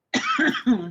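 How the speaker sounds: sharp cough clearing the airway; choking cough. Real, not acted. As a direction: cough out what went down wrong. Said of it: "I went down the wrong way".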